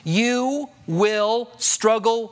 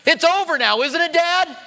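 put-on higher, louder pleading kid voice; kid asking dad; 9 semitones higher, 3 dB louder than usual. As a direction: play the kid hoping the scolding is done.

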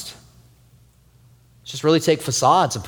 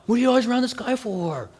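mock jealous husband; 7 semitones higher than usual. Retelling jealous words to wife.

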